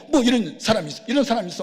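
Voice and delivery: loud, high shocked protest; aghast. Retold or imitated. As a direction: recoil in shock from the intruder. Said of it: "막 새파랗게 질려가지고". (기겁해서 높고 크게 내뱉는 말)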